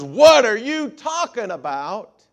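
loud, high, slow outraged mother; indignant mother. Retold or imitated. Mother yells back at her saved child, loud and outraged. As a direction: hit every word like a shocked, angry mom. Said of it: "the mama goes".

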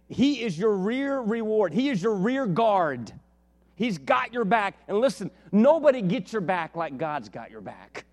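full-force preaching. Real, not acted. Preacher assuring congregation God has their back, emphatic proclamation. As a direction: hammer each phrase slowly from the pulpit.

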